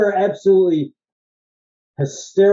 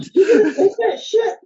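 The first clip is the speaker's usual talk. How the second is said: squeaky high laughing quote; laughing disbelief; 3 dB louder, slower than usual. Retold or imitated. Cracking up at a sexist movie line, laughing. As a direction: blurt it out through laughter. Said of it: "I was like".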